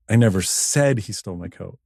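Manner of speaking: "said" picked out with emphasis; stress on SAID. Shown as a demonstration. DJ showing ways to say one line, stress on "said". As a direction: lean hard on the word "said". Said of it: "this many ways to say this".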